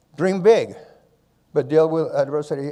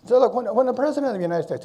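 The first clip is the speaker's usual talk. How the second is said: normal talking voice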